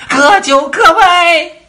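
high, loud shout; loud command. A narrator's reading. Queen shouting a command to everyone, loud. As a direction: bark the order at the crowd. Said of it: "王后喊了一声".

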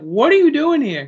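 put-on the girlfriend can't believe he's home; imitating an overjoyed, high-pitched shriek; delighted surprise. Girlfriend sees him home early.

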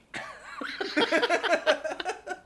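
long, high, loud cackle; laughing. Real, not acted. Friends cracking up over hits given up, high laughter. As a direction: burst into high helpless laughter.

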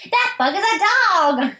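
high cartoon voice. Quoting Beetle Ball line.

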